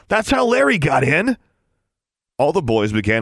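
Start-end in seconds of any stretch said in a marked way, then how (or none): 0.1-1.4 mocking. A narrator's reading. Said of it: "suggested Wade".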